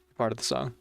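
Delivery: ordinary talk, nothing marked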